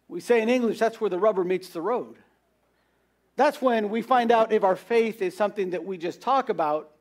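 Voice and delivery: ordinary talk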